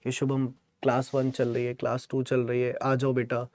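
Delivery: soft, low, mockingly sweet phone call; sarcastic mock invitation. Teacher mocking a student who skipped class, soft and sarcastic. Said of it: "मुझे आपको phone करना चाहिए था".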